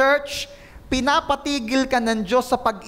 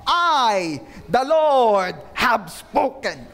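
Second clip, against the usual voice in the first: slow, weighty pronouncement, each phrase set apart; emphatic declaration; 3 semitones lower, much slower than usual. Acted. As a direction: declare it slowly and solemnly, pausing after "I". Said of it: "idiniin pa ni Lord kung sino nagsasalita".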